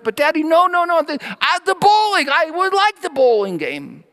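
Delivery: put-on high, pleading little-kid whine; whining kids. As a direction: beg Daddy like a small child. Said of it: "They were starting to press".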